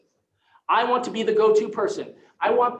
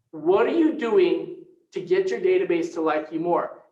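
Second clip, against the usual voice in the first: ordinary talk, nothing marked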